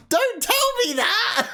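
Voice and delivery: high laughing shriek at chat; high laughing squeal. Real, not acted. Repeats protest, higher.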